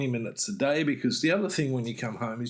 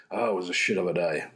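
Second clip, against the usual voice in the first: grumpy complaint; 6 semitones lower, faster than usual. Shown as a demonstration. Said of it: "That's not really how to do it".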